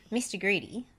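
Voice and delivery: ordinary delivery, nothing marked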